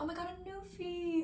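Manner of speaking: high, soft imitated amazement; hushed gushing. Imitating people who constantly spot the dog, softly gushing. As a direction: coo it high and softly like an awed stranger.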